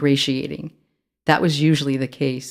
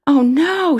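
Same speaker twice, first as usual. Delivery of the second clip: sudden high alarmed yelp; panicked cry; 12 semitones higher, 6 dB louder than usual. A narrator's reading. Girl panicking at a police stop, high alarmed cry. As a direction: blurt it out in fresh panic.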